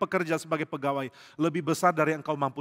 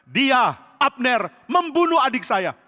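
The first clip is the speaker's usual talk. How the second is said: higher and louder, with Abner dragged out slowly; angry accusation; 9 semitones higher, 9 dB louder, slower than usual. Acted. Joab tells the king Abner killed his brother, furious. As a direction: accuse with bitter weight.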